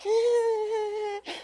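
high-pitched giddy squeal of excitement; mock girly squeal; girlish squeal. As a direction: squeal like a smitten church girl. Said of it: "all the girls are like".

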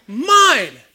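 put-on loud, high childish snatch of the word Mine; greedy child. Little Johnny snatching the toy from Susie, loud childish claim. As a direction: grab it like a toddler claiming a toy. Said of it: "Who taught that child to say".

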